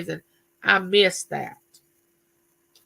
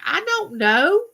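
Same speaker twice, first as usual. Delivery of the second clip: high, slow, helpless I don't know; flustered I don't know; 10 semitones higher, slower than usual. Retold or imitated. Lost wife at husband's map question, helpless and high. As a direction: squeak it out, totally lost with the map. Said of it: "I'm like".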